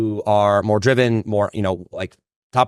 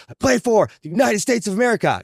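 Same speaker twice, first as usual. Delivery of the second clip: retold player shouting; 12 semitones higher than usual. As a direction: shout it back like a patriotic vow. Exhausted player finally answers coach.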